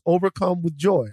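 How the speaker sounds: ordinary delivery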